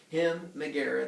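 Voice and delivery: ordinary talk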